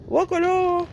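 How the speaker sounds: high delighted cry; joyful squeal. Real, not acted. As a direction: squeal it happily. Cri de joie. (cri joyeux aigu)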